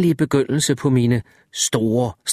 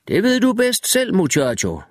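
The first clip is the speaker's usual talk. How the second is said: calling out; 8 semitones higher than usual. A narrator's reading. Wireman calls back.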